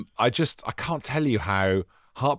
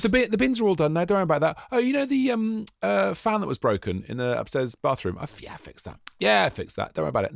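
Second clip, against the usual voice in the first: high, eager, people-pleasing voice to Donna; eager husband; 9 semitones higher than usual. Retold or imitated. Telling his wife Donna the chores are done, high and eager. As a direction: sound keen to earn brownie points. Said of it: "To lay the groundwork".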